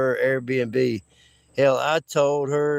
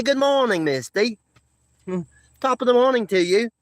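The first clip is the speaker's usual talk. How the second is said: put-on high, playful English accent greeting; 6 semitones higher than usual. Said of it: "English accent, uh, impersonation".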